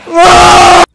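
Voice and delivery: loud high frustrated scream; angry scream. Real, not acted. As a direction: scream out the rage. Rage in game.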